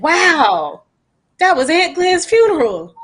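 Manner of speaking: a mock-amazed reaction, high and lifted; retold surprise. Seeing the photo from the aunt's funeral: high retold amazement. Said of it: "And I was like".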